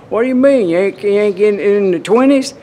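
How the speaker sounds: mock challenge, pitched high and loud; incredulous riders. Serious riders challenging his average speed, mock incredulous. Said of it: "I know y'all going".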